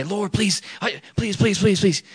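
high-pitched mock pleading; begging. Showing how not to pray to God, mock begging. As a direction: beg in a high, put-on voice. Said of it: "I wouldn't beg him".

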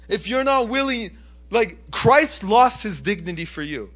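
impassioned pulpit exhortation; forceful preaching. Acted. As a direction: punch the key words like a fired-up preacher.

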